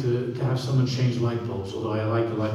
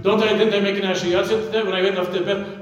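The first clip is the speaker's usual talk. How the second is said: higher, louder, pleading protest; protesting worshipper; 7 semitones higher, 3 dB louder than usual. Retold or imitated. Worshipper insisting to Hashem, higher and louder. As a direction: plead your record before God. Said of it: "then you say to Hashem".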